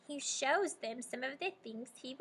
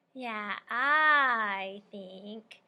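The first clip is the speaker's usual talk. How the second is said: slow drawl; 2 semitones lower, 6 dB louder, much slower than usual. Acted. Teacher echoing the slow drawl.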